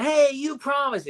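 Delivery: high-pitched wronged protest; high little-guy complaint; protesting character voice. Retold or imitated. Acting out Squinch's hurt protest.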